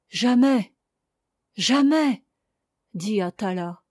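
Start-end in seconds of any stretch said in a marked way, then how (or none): 0.1-2.2 rising insistence, second word higher; anguished refusal. A narrator's reading. Dying girl denies her lover any hope, anguished refusal.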